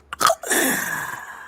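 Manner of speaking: high breathy monster giggle; a gloating unvoiced snicker; eerie whispered cackle. Creature laughs mid-taunt.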